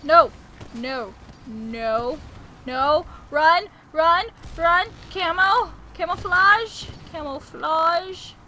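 loud frantic yelling; loud panicked commands; panicked shouting. Real, not acted. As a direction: scream at yourself to run. Fleeing a titan in panic.

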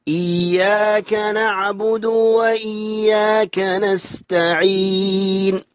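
slow supple chant, doubled y in إياك; smooth doubled ya. Shown as a demonstration. Teacher models correct reading, slow smooth chant with doubled y in إياك. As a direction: recite slowly and smoothly, pressing the y of إياك. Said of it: "تشدد الياء مع الليون والسلاسة".

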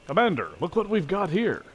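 high, loud eager soldier; excited soldier. Acted. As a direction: call it out proudly to the commander. Soldier reports to commander.

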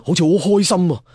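usual speaking voice

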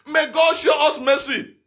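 normal talking voice

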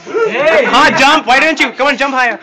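loud, high excited cheering. Real, not acted. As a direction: hype everyone up to jump. Cheering people jumping in lift.

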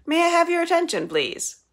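high, loud, fast announcement; calling out. A narrator's reading. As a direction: call the crowd to attention. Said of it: "she calls".

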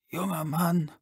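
put-on high, strained old man voice. A narrator's reading. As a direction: press the words out like a frail old man. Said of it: "presste der Alte hervor".

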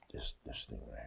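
breathy whisper while searching pages; hushed searching; whispered muttering. Real, not acted. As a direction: whisper to yourself while looking.

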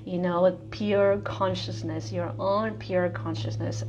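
ordinary delivery

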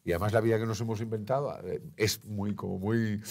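ordinary delivery

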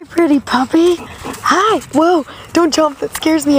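high, sweet voice for the puppy; gentle pet-talk greeting, high; dog talk. Real, not acted. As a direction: coo at the dog. Talking to a dog.

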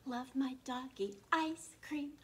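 plain reading voice

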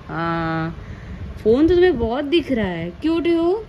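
high, coaxing mother's voice, pleading him not to cry; mother soothing a newborn who starts to cry. Real, not acted.

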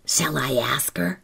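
breathy conspiratorial whisper. A narrator's reading. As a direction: whisper it to the other girls. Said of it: "she whispered".

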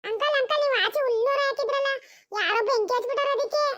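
put-on high child's voice, breathless news; a small boy bursting with the news; boy telling uncle about the fire; child reporting.

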